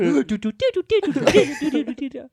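rapid high "doo-doo" piano riff; imitated piano part. Shown as a demonstration. Showing friends the song's piano part, high sung syllables. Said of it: "I'll even do the piano part".

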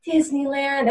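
soft, high dreamy sigh; hammed-up wonderstruck bliss; blissful happiness. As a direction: sigh it like a movie heroine. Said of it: "in a movie, so happy right now".

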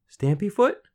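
put-on high questioning girl voice; tentative suggestion. A narrator's reading. Girl guessing the name, tentative. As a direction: venture a guess, lifting high.

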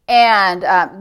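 normal talking voice

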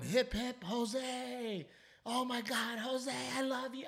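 high, far-off mock crowd chant; imitating screaming crowd. Retelling fans going wild, mock crowd screaming. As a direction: squeal it like a crowd losing its mind.

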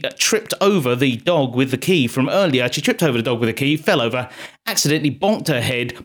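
usual speaking voice

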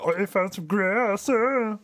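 high, whiny nerd; squeaky, eager nerd voice. Retold or imitated. Mocking Titus's nerd voice.

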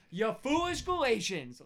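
high, slow exasperated scolding; angry Paul. Shown as a demonstration. Showing his son how Paul scolds Galatians, angrily. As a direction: scold them in hammed-up outrage, drawn out. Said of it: "read it as angry Paul".

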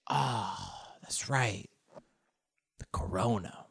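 low, soft, sighing letdown; deflated, trailing off quietly; deflated realisation. Acted. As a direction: let all the air out as it sinks in. Acted chat deflates back to corona.

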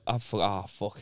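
low, soft panicked mutter; muttered inner panic. Retold or imitated. Lost during a podcast chat, low panicked mutter. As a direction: mutter it under your breath.

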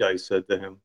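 usual speaking voice

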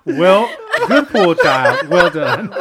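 loud laughing praise; cracking up while praising. Real, not acted. Laughing hard after joke.

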